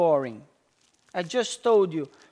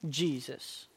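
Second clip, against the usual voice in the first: hushed answer; 8 semitones lower, 13 dB quieter than usual. Acted. Call-and-answer, answering own question.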